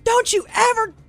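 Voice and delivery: high, loud scolding shout; husband yelling at his wife after the false alarm; angry yell. Retold or imitated. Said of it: "he starts screaming at me".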